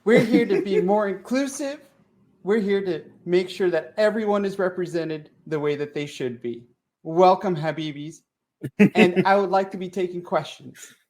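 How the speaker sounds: mock Taliban spokesman.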